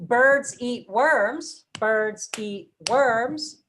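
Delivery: BIRDS, EAT, WORMS slow and evenly punched; one strong beat on each of birds, eat, worms; stressed content words. Shown as a demonstration. Teacher showing which words get claps, slow stressed chant. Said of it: "that gets emphasized with word stress".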